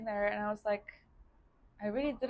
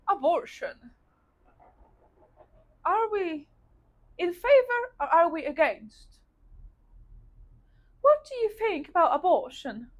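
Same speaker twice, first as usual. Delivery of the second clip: higher, louder, declamatory lecturer; imitated professor; 7 semitones higher, 6 dB louder than usual. Professor opening first class debate on abortion, imposing. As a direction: put the question to the class in dramatic pauses.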